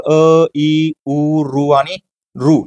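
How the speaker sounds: अ, ई, उ, रू sounded one by one, slowly; vowel demonstration. Shown as a demonstration. Teacher listing five short vowels for students, slow separated sounds. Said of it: "हे पाच ऱ्हस्व स्वर".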